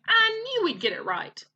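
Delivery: high-pitched triumphant cheer. A narrator's reading. Mister Beaver admires the improved wooden foot, triumphant. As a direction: crow it high with satisfaction.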